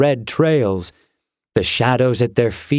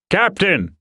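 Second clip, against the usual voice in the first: urgent high-pitched call; 7 semitones higher than usual. Acted. Soldier alerting the captain to approaching Titans, urgent high call. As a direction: call sharply for the officer's attention.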